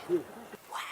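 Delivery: excited whisper shout; whisper yelling. Shown as a demonstration. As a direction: shout with joy, but only in a whisper. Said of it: "I had to whisper shout with joy".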